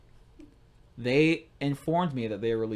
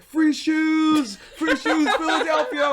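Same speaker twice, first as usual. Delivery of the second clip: high, loud excited yell; overexcited whooping holler; re-enacted hollering; 14 semitones higher, 7 dB louder, much slower than usual. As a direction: shout it to the whole street. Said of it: "took a video of me going".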